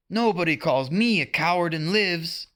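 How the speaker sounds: put-on high boyish shout of pride; young hero answering an insult; defiant shout. A narrator's reading. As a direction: hurl the threat back. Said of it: "Peter shouted back".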